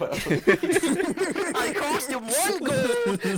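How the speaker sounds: high, laughing through the words; real laughter breaking up the sentence; laughing hard. Real, not acted. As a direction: tell it helpless with laughter. Laughing explanation of the botched roll.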